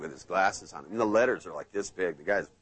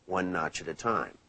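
hypnotic trance voice; 6 semitones lower, slower than usual. Shown as a demonstration. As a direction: lull them down one notch at a time. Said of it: "I like to say, like, for example".

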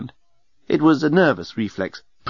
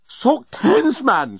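high-pitched outburst, falling at the end; mock shout of alarm; exasperated shout; 13 semitones higher, much slower than usual. A narrator's reading. Journalist shouts at rambling Leovinus, exasperated. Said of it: "shouted the journalist".

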